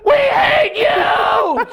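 very high, loud, slow wailing yell; crowd jeer. Retold or imitated. Comic mimicking the crowd's wailing jeer, very high. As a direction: wail the hate at him. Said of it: "And I'm like".

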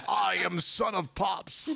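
slow, laughing grand announcement; Son of Pops voice. Pretend. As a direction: proclaim it slowly through laughter.

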